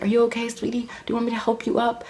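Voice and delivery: high, softened mom voice; high-pitched put-on motherly concern; imitated concerned mom. Voices mom after her fall.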